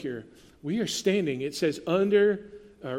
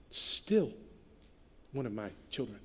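gentle reassurance; 7 semitones lower, 9 dB quieter than usual. Acted.

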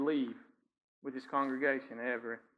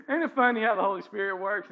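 ordinary delivery

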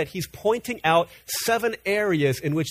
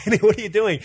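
surprised call; 6 semitones higher, 6 dB louder, faster than usual. Retold or imitated. Father calling out to toddler.

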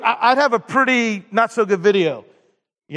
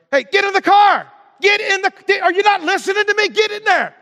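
put-on high, angry yell at the kids; hollering; 13 semitones higher, 5 dB louder than usual. Preacher as dad to his kids in a parking lot, hollering. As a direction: yell like a parent at the end of their rope. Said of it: "I keep on hollering".

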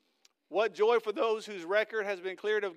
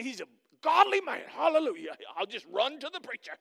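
mock churchgoer voice; 3 semitones higher, 4 dB louder than usual. Member leaning on the pastor, admiring church-member voice. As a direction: gush like a starry-eyed congregant. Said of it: "I don't know why I started talking like that".

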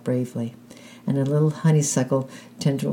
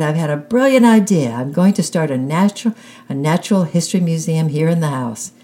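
bursting with a brilliant idea; excited boy; 3 semitones higher, 6 dB louder than usual. A narrator's reading. Boy telling mom his big idea, loud and excited. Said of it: "he cried".

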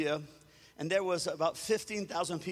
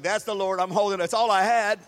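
louder, emphatic declaration; clinging conviction; 2 semitones higher, 10 dB louder than usual. Retold or imitated. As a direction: declare it like grabbing a lifeline. Grabbing onto God's word.

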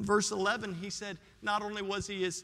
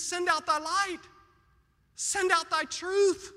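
high, insistent appeal; pleading with God; 7 semitones higher, slower than usual. Acted. Psalmist pleading for light and truth, high and earnest. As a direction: call out your petition to heaven. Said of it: "Notice he pleads with God".

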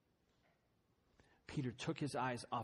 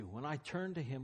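ordinary talk

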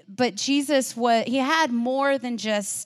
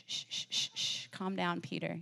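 hushed, softer, breathy calming of an eager friend; soothing shush; 4 semitones lower, 11 dB quieter than usual. Retold or imitated. Jesus hushing overeager Peter on the mountain, soft and soothing. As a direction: shush him gently, almost no voice. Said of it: "Jesus is over there like".

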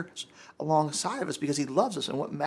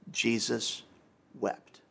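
hushed solemn reading; 6 semitones lower, 6 dB quieter, much slower than usual. Real, not acted. Preacher reading the shortest verse to the congregation, hushed. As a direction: lower and softer, solemn.

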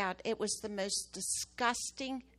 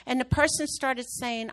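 normal talking voice

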